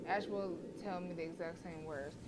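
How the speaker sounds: usual speaking voice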